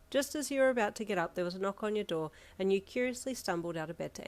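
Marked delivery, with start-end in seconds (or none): none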